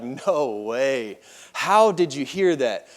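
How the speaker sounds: low, soft, slow disbelief; hushed amazement. Retold or imitated. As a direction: voice a stunned man, quietly and slowly. Retold man stunned by cricket.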